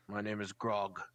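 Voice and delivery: a hint of a dim hulking creature; gruff captor. GM voices the captor Grog.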